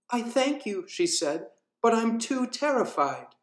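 put-on understated scared child voice; lightly voiced timid girl. Hester answers the tiger.

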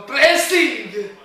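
loud, slow excited call. Real, not acted. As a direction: belt out the praise. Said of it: "si è alzato un po' la voce quando ho detto pressing".

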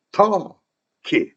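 "터키" with a long, high "터", then "키"; stress on first syllable. Shown as a demonstration. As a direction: slowly lean on the "터" of "터키". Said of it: "어에 강세가 있죠".

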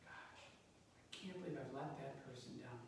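very soft, inward dismay; quietly acted remorse; hushed self-reproach. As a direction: say it softly, as if privately regretting it. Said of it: "You know that feeling".

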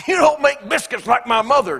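hammed-up critical husband; mock critical husband. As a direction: whine about the biscuits. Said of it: "talking like that".

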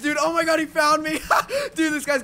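high, loud panicked cry; panicked shout. Real, not acted. Gamer yelling as an enemy finds him, panicked. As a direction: yelp it like you're caught.

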